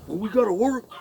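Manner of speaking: hammed-up whiny boss; mocking excuse. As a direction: parrot the boss's excuse. Mimicking Scott's excuse.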